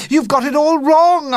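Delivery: put-on boy crying out, high. A narrator's reading. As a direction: protest urgently. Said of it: "I cried".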